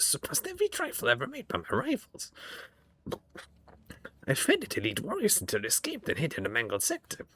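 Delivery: high, eerie surgeon creature voice; creepy homunculus voice. Acted. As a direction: voice a gloating flesh-crafter, high and strange. Homunculus voice continues.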